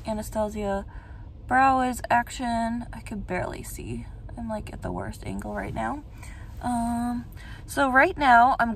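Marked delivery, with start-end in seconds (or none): 2.9-6.0 soft unvoiced whisper. Real, not acted. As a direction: breathe the words, barely voiced. Talking close while doing makeup.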